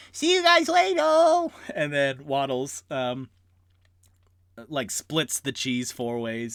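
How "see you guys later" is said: much higher, louder goodbye; cheerful little rat farewell. Acted.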